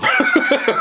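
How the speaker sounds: sudden high-pitched laugh; high squeal laugh. Real, not acted. Laughing at own joke.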